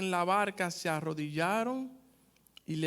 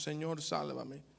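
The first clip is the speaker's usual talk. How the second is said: normal talking voice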